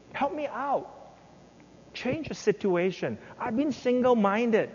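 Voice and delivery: high, pleading prayer of a hurt servant; pleading Hezekiah. Acted. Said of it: "Remember we read it?".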